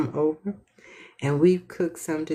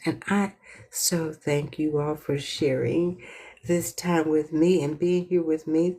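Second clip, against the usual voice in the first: usual speaking voice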